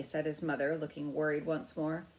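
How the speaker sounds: plain reading voice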